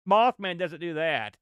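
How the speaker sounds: ordinary talk, nothing marked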